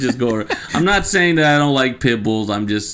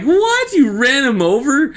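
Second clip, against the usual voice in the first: loud, high, disbelieving what; shocked disbelief; 4 dB louder than usual. Retold or imitated. Hearing his dog got run over, loud shocked question. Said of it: "I'm like".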